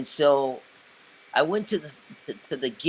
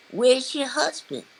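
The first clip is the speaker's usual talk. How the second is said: much higher, bright sing-song mimic of June; imitating [name]; 12 semitones higher, 4 dB louder than usual. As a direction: voice June sweetly asking after the husband. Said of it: "[name] says".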